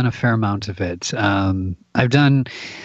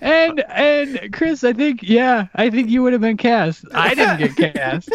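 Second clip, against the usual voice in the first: laughing; 11 semitones higher, slower than usual. Real, not acted. Laughing at his failed audition.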